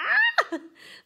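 high sudden burst of laughter; surprised high laugh; startled laugh. Real, not acted. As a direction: yelp into a laugh.

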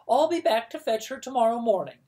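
loud, high, fast commanding aunt; brisk bossy declaration. Acted.

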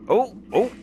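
two high, loud yelps of "oh"; sharp, surprised "oh!"; startled. Real, not acted. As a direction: jump at a sudden find. Startled by something in game.

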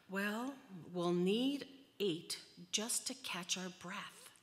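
heavy sigh, then animated resignation. A narrator's reading. Reader as overworked Leo calling for an eighth, sighing. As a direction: sigh out loud and carry on, trailing off at the end. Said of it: "Leo sighed and said".

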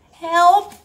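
loud, high stuck-man call; cry for help. A narrator's reading. Man stuck halfway through a squat, calling for help. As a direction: call out in comic alarm. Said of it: "zei hij, "help, ik kan niet meer".

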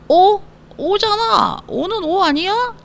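high-pitched mock puzzled learner; mistaken student. Teacher imitating a student misreading the spelling, high mock puzzlement. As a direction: protest in a high voice, sure it's obvious. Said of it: "하시면 안 돼요".

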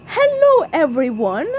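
high, loud singsong greeting; smiling princess. A narrator's reading. Real princess greeting the crowd, smiling and high-voiced. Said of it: "she said, smiling and waving".

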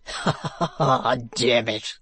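breathy foppish laughter, slow; affected laugh. A narrator's reading. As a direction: laugh elegantly. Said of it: "cried the gentleman".

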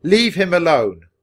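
loud, high, forceful command; commanding shout in defence of a friend; shouted order. A narrator's reading. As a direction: warn him off at full voice. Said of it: "he ordered the man".

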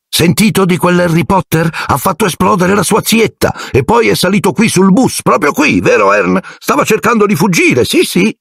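very high-pitched gossip voice; gossiping conductor. A narrator's reading. Stan's imagined gossip to night bus passengers, gossipy character voice. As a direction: spread the tale in a high character voice.

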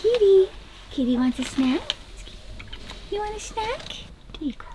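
high soft cooing. Real, not acted. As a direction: coo softly at the kitty. Talking to a cat.

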